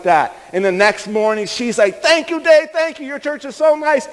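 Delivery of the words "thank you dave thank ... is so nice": very high imitated woman's excitement; gushing thanks. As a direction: gush gratefully in a high voice. Grateful woman imitated next morning.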